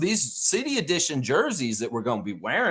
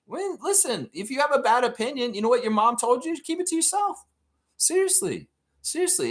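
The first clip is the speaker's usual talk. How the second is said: much higher, mock-stern scolding; 9 semitones higher than usual. Real, not acted. Host scolding critics, high and mock-stern. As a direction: lecture them like a mom would.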